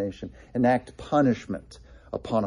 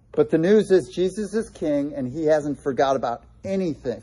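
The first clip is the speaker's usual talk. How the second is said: usual speaking voice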